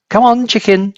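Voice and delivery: high coaxing call to a hen; boy luring the hen with a spoon. A narrator's reading. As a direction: coax it gently closer. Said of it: "George said".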